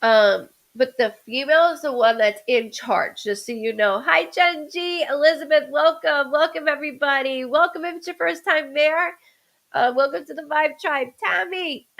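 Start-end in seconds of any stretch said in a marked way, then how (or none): 4.0-11.9 high bright hello to the viewers; cheerful livestream greeting. Real, not acted. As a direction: welcome everyone warmly on stream. Greets live chat viewers.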